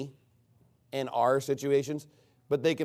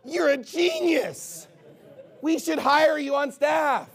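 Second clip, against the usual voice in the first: much higher and louder, a mock-amazed whoop, then slow sarcastic praise with a laugh; sarcastic amazement; 11 semitones higher, 6 dB louder, much slower than usual. Pastor mocking a member's building suggestion with loud sarcastic amazement. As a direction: gush over the obvious idea like it's genius, dripping sarcasm.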